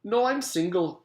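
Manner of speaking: a pleasant Scottish gentleman's call; pleasant stolen voice. A narrator's reading. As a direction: sweetly answer the door. Mister Gorf pleasant.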